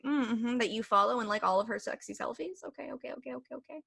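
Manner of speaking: soft, knowing, unconvinced okays; sarcastic disbelief. Retold or imitated. She doubts his story about the girl, sarcastic and quiet. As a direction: let him know you don't buy it. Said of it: "I'm like".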